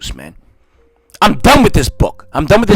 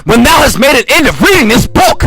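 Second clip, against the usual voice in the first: repeated higher and louder; emphatic repeat; 10 semitones higher, 5 dB louder than usual. Shown as a demonstration. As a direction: hammer the line home the second time. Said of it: "repeated with force".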